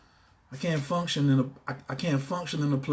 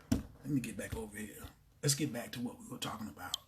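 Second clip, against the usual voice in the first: much lower, softer muttering; quiet aside; 7 semitones lower, 15 dB quieter than usual. Real, not acted. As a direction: settle back down. Calms down after outburst.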